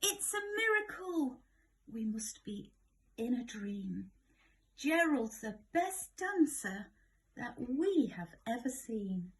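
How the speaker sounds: high, loud amazed shouting. Acted. Animals amazed at Gerald's dancing, excited high shout. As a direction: cheer him in astonishment. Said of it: "They shouted".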